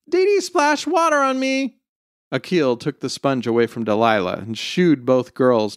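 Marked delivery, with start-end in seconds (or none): 0.1-1.8 put-on very high, sustained little-kid complaint; mock shriek of a six-year-old; child's shriek. A narrator's reading. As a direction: tattle in a squealing child's pitch. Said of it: "Jasmine shrieked".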